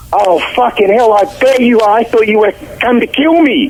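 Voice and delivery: high-pitched retelling of a spooked man's outburst; startled co-star. Retold or imitated. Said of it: "he looks at me, he goes".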